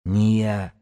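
strangled denial; hoarse, choked protest; choked wheeze. A narrator's reading. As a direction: squeeze the words out as if throttled. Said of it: "просипел бегун".

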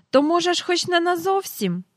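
високо, здивовано й гучно; ледь не вигукни від подиву. A narrator's reading. Здивований адмірал питає. (здивоване запитання адмірала)

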